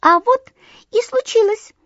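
very high, chirpy bird voice; cheeky titmouse chirping at the sweep. A narrator's reading. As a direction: chirp it like a lively little bird. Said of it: "чиликнула бойкая синичка".

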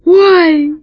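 high, loud startled yelp; acted cry of alarm; frightened cry. A narrator's reading. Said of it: "раздался испуганный крик крыса".

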